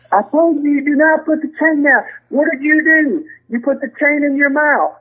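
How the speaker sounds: imitating Blackie. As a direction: replay the angry veteran grilling him. Retold wrestler scolding rookie.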